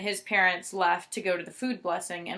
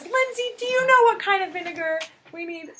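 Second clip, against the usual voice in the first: much higher mock-innocent mom voice; imitating mom; 10 semitones higher than usual. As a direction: ask it sweetly fake, pitched up. Mom asking Lindsay, retold.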